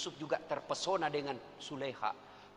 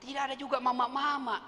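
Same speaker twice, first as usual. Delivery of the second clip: normal talking voice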